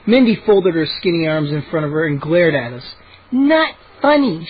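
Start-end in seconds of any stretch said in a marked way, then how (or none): 3.3-4.5 high, slow cross retort; snapping. A narrator's reading. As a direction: make each word a separate rebuke. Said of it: "she snapped".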